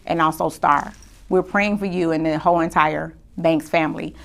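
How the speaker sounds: host honoring a friend who passed, softly grieving; grieving tribute. Real, not acted. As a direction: hold back tears gently. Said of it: "I'm gonna try not to cry".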